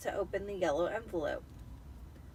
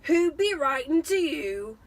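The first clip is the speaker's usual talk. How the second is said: louder, slow sneering gruff voice; 6 semitones higher, 9 dB louder than usual. Acted. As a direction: sneer it down at the boy. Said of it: "sneered Uncle Vernon".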